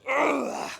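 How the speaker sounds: high loud strained grunt; effort grunt. Real, not acted. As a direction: groan through the lift.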